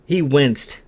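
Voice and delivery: usual speaking voice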